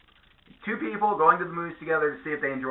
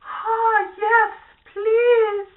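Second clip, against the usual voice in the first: very high, louder swooning falsetto; swooning woman; 6 dB louder, much slower than usual. Retold or imitated. Women answering the hot beefcake in a swooning falsetto. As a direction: squeal it like a smitten fan. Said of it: "They'd be like".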